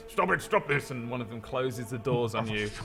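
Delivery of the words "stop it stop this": higher, commanding guard; guard's order. Acted. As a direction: bark it like a guard. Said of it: "they're like".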